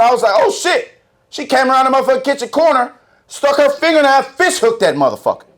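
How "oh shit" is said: sudden high-pitched panic, retold; the frozen-in-fear moment, relived; panicked gasp. Dad retelling his toddler choking, panicked high yelp. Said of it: "I was emotional".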